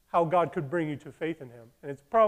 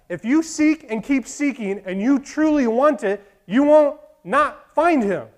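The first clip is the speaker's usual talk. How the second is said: loud, higher pulpit voice; impassioned sermon voice; preaching climax; 9 semitones higher, 11 dB louder, slower than usual. Real, not acted. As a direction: drive it home to the church.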